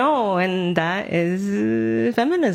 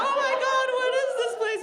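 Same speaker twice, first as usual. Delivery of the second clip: panicked newborn; 4 dB quieter, much faster than usual. Retold or imitated. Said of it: "We're like".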